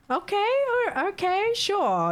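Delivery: high, slow sarcastic okay, sure; mock sarcastic okay. Vlogger mocking a caller with sarcastic high okays. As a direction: dismiss her with a drawn out high okay.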